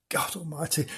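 usual speaking voice

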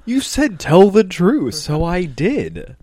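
loud, high, drawn-out objection; slow, hammered-out self-defence; mock indignant protest. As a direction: protest like a wronged kid. Retold protest to scolding dad.

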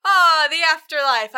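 grand speech opening, loud and high; mock-stately oratory; Osiris. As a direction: proclaim it like a god-king. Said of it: "Osiris has, um, a speech".

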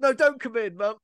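high-pitched call to mum; mock annoying protest. Boy contradicting his mimic to mum, high mock protest. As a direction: protest high, as the pestered son. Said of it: "annoying thing where he's, like, going".

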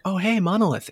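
happy recognition. Retold or imitated. Imagined fan spotting his shirt.

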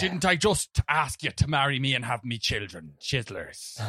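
mock old-Irish brogue, falling away at the end; put-on stage Irish. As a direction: plead in a hammed-up Irish accent. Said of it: "That's the accent we put on".

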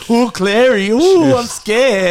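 high, slow mock fright; mock scared. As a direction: ham up being scared. Mocking spooky story.

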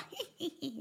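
high mock giggle as the baby; imitated baby giggle. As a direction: giggle like a tiny cheeky baby. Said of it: "Eh, bebisen". (härmat bebisfnitter)

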